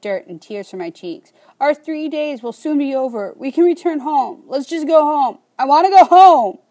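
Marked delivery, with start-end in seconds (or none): 1.6-6.6 building to a loud, high, sobbing wail; halting sobs. A narrator's reading. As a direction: plead through tears, louder with each line. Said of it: "The words somehow come out between halting sobs".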